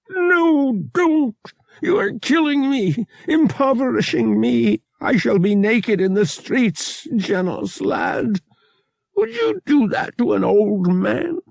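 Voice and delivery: put-on high, pleading squeaky old man; squeak. A narrator's reading. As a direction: squeal it out in mock anguish. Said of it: "he squeaked".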